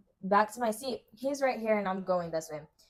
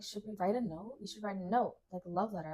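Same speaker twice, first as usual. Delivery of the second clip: mock scheming hush; 10 dB quieter, faster than usual. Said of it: "my friend is like".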